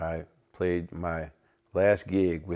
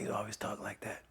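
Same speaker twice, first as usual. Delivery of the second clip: raspy near-whisper, breathy and voiceless; whispery imitation of a raspy legend; 4 semitones higher, 12 dB quieter than usual. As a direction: rasp it out like an old jazzman. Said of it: "he spoke with a rasp".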